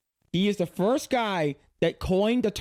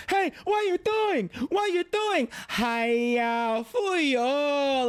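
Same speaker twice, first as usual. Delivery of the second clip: Uncle Roger; 6 semitones higher, slower than usual. Retold or imitated. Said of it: "Uncle Roger accent".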